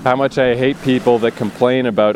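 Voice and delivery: ordinary talk, nothing marked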